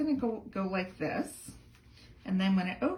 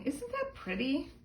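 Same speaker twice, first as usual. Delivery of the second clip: delighted; 8 semitones higher than usual. Real, not acted. Admiring her stamped card.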